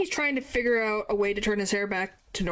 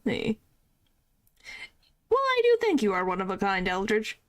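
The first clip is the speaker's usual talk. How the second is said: ordinary talk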